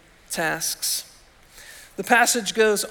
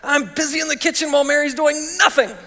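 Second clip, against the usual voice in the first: raised, higher pitched mock complaint; higher indignant whine, a bit louder; mad Martha; 6 semitones higher, 4 dB louder, faster than usual. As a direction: play Martha fuming in the kitchen. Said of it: "the madder Martha got".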